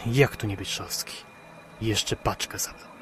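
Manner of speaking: soft, bitter mutter to himself; quiet grumble. Acted. Driver alone in the cab grumbling quietly and bitterly. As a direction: sink back into sour grumbling.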